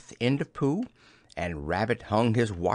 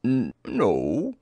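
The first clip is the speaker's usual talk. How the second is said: slow bear voice, lightly casual; hesitant start, offhand bear character; a careless, unhurried bear; careless Pooh. A narrator's reading. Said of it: "said Pooh carelessly".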